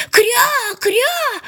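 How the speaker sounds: very high drawn-out quack; duckling's startled cry. A narrator's reading. Frightened duckling cries out at the boy, high quacking. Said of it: "да как крикнет".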